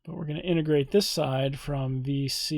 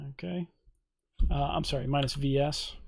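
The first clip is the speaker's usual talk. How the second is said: ordinary delivery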